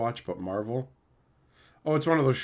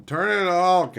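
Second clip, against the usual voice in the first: higher, louder mock yell; 6 semitones higher, 5 dB louder than usual. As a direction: imitate gamers yelling over voice chat. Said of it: "they're yelling".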